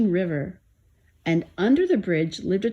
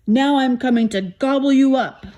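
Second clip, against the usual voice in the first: higher, louder storybook threat; troll roar; 6 semitones higher, 5 dB louder than usual. A narrator's reading. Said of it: "roared the troll".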